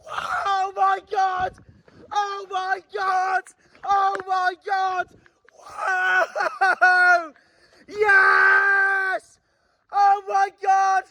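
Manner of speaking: high, slow shouted disbelief; drawn-out shouts of joy; overjoyed disbelief. Real, not acted. Fan reacting to promotion, stunned joyful shouting.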